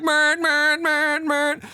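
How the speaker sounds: high, mocking repeated man; mock trucker droning. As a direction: parody the lone trucker's chant. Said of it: "He's just like".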